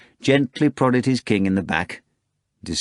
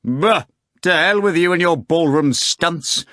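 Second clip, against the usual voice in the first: grumbling; 8 semitones higher, 5 dB louder than usual. A narrator's reading. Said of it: "Volant grumbled".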